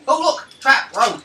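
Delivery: loud, high, drawn-out imitation of game chatter; mock game voice. Player imitates Dragon Age trap warnings to friends, loud mocking voice. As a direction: mimic an over-eager party member, loudly. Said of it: "It's like".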